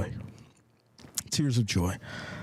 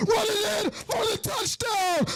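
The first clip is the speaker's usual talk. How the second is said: very high, stretched-out cries of triumph; real high-pitched commentator's frenzy; ecstatic touchdown call; 13 semitones higher, slower than usual. Real, not acted. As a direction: erupt as the runner breaks through and scores. Live call of a touchdown run.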